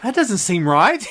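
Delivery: hammed-up skeptical Bruce Banner; doubtful Banner. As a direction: drag it out like you can't buy it. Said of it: "And Banner's like".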